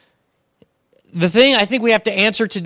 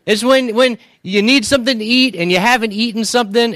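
ordinary talk, nothing marked

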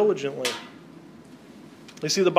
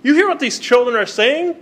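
higher, indignant outrage; indignant priests; 7 semitones higher, 6 dB louder than usual. Retold or imitated. Chief priests confront Jesus about the children, indignant. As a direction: demand it of Jesus, offended. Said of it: "they're indignant and said to them".

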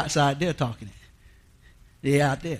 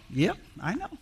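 mild weary friend; 3 semitones higher, 10 dB quieter than usual. Retold or imitated. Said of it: "he's just going".

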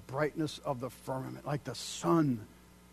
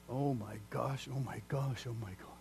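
awed hush; 3 semitones lower, 7 dB quieter than usual. Real, not acted. Awestruck after the verse.

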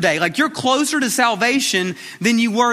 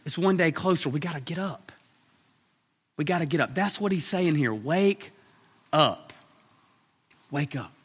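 much softer, lower intimate plea; hushed urging; 3 semitones lower, 7 dB quieter than usual. Acted. Preacher quietly urging listeners to wake up, much softer. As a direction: lean in and say it low.

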